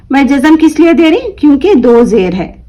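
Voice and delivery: usual speaking voice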